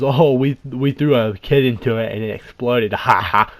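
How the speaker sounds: loud mock amusement capped with a "ha ha"; laughing retelling. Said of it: "He laughs and says".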